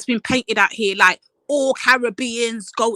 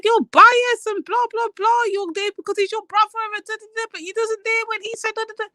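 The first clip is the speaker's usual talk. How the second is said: high sing-song mockery of her complaint; mocking whiny voice; 11 semitones higher than usual. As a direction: parrot her in a high, silly whine. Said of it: "She said".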